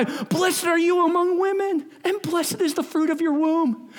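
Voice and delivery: high ringing acclaim, slowed on the first line; loud cry. Acted. Elizabeth crying out a blessing to Mary, high and ringing. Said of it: "she acclaimed with a loud cry".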